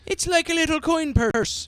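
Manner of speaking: high squeaky leprechaun voice. Acted. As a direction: chirp it like a leprechaun.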